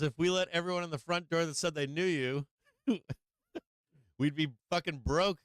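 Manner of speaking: brother's line broken by laughter; laughing through it. Retold or imitated. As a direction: deliver the punchline while laughing. Brother's punchline, teller laughing.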